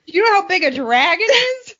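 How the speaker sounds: high, loud laughing disbelief; laughing incredulous retort; mock outrage. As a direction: answer with the same fake indignation. Joking comeback, same outrage.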